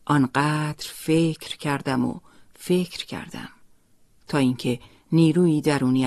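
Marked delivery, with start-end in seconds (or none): none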